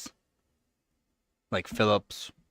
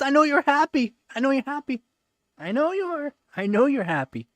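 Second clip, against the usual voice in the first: pet talk; 5 dB louder, faster than usual. Real, not acted. To the purring cat, high baby talk.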